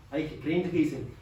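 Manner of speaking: usual speaking voice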